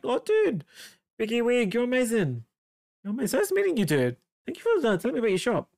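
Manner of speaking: high, excited thanks with swooping pitch; gushing farewell. Real, not acted. Streamer says goodbye to viewer Biggie Wig, gushing. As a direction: gush with delight at a new friend.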